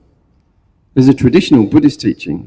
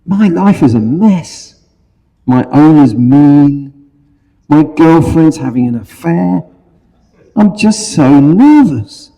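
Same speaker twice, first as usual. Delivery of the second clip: snooty poodle voice; 5 semitones higher, 3 dB louder than usual. Acted. Poodle complains to Labrador.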